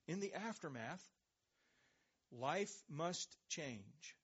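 drawn out slowly, each word weighed; slow solemn emphasis. Acted. Preacher opening his conclusion, slow and weighty. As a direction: let it land slowly and solemnly. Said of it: "here's our conclusion".

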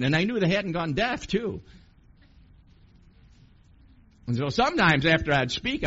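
loud, high, driving preacher voice; building fervor, the crowd hanging on every word; full-force preaching. Acted. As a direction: preach it out to the whole room.

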